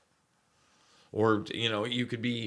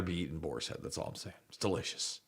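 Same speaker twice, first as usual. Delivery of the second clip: whispering; 5 semitones lower, 12 dB quieter, faster than usual. Real, not acted. Aside about Boar's Head.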